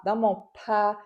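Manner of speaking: usual speaking voice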